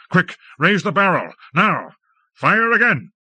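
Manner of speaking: high urgent shouting; frantic orders. A narrator's reading. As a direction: hurry him with every word. Feverish signals to fire again.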